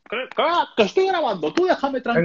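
high mocking voice of a dismissive cameraman; mock Marcos annoyed; cameraman brushing off. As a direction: imitate Marcos waving you off. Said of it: "Marcos quote".

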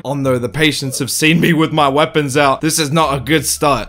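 normal talking voice

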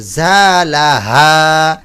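জালাহা with the middle া stretched slowly; জালাহা held long; elongated vowel. Shown as a demonstration. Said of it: "টান হবে".

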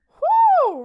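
loud high whoop of excitement. Real, not acted. Player cheering at the rival gang option, loud whoop. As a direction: let out a cheer.

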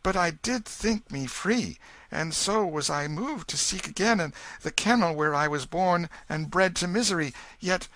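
put-on boy humbly asking to go home; humble answer. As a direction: explain meekly. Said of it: "answered humbly".

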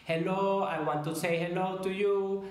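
choppy English, every syllable separate, Spanish style; Sofía Vergara style English; English with Spanish rhythm. Retold or imitated. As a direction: imitate a Spanish speaker's even beat in English. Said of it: "como Sofía Vergara, inglés ... pero con el ritmo del español".

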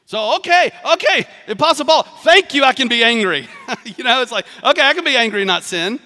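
hammed-up relief; mock delight. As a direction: play it like a kid let off the hook.

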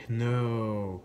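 usual speaking voice

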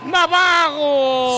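drawn-out roaring goal call; explosive goal commentary. Real, not acted. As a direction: stretch the name like a stadium call. Second long goal call.

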